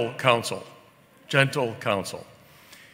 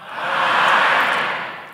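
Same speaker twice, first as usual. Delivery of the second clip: crowd shouting aye; 3 dB louder than usual. Real, not acted. Crowd voice vote.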